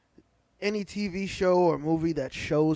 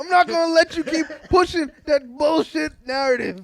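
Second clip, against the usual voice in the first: laughing shout; 8 semitones higher, 8 dB louder than usual. Real, not acted. Friend protests a false claim.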